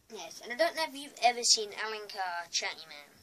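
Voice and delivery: ordinary talk, nothing marked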